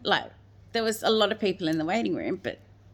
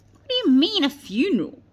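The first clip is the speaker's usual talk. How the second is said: high, loud cry of disbelief; shocked disbelief; 9 semitones higher, 7 dB louder than usual. Retold or imitated. Mother told to organise a funeral, shocked outburst. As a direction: blurt it out, stunned and louder.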